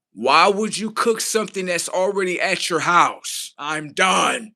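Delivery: ordinary delivery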